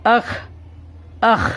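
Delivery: اخ sounded out as "akh" with throaty kh; isolated, demonstrated اخ, "akh"; two letters joined. Shown as a demonstration. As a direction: run short a into kh in اخ. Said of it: "اس طرح ملائیں گے".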